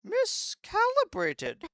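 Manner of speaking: a fading machine mother releasing her daughter; relenting robot queen. Acted.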